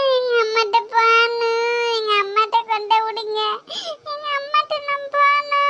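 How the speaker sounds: high whining cry on long held vowels. Acted. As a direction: wail for mother like a crying pupil. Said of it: "எதுக்குமா அழுவிய?".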